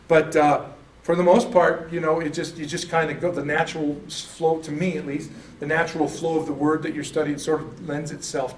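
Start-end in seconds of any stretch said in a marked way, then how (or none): none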